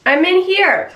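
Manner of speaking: high, loud call through the house. Acted. Prankster luring partner to bathroom, calling out. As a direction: call out so your partner finds you.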